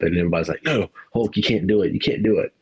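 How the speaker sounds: normal talking voice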